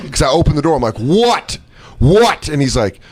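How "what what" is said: high, impatient challenge; angry demand. Retold or imitated. Wet man opening door to driver, angry high demand. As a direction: fire it at the person who buzzed.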